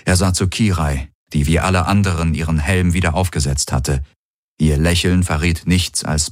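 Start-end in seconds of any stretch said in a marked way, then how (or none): none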